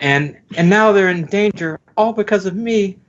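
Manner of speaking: put-on high-pitched sweet girl voice; high girly voice. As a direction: lift into a girlish falsetto.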